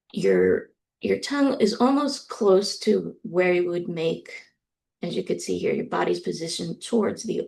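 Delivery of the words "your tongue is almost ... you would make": usual speaking voice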